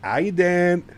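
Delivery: high, loud New York greeting; mock New Yorker, a bit overdone; New York accent. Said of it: "I can't do the accent properly".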